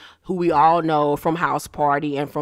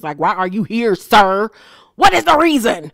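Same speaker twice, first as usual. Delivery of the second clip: exasperated outburst; 3 semitones higher, 7 dB louder than usual. Retold or imitated. Vlogger mock-questioning the husband on the show, loud exasperated. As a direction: call him out, getting loud. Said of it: "And I was like".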